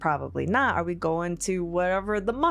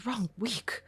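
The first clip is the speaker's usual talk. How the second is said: sulky brute voice; 7 semitones higher, much slower than usual. Acted. Sulking Ront, brokenly.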